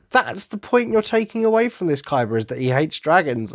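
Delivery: ordinary talk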